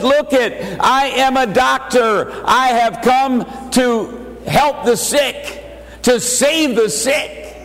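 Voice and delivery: fervent pulpit preaching. Acted. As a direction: preach it out to the congregation. Preaching at full force.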